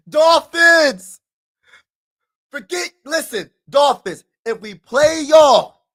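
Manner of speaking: high, loud frustrated call-out; real frustrated yelling; frustrated shout. Real, not acted. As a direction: yell at the rival team. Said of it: "I'm frustrated".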